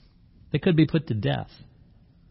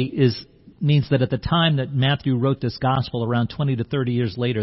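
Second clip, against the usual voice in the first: ordinary talk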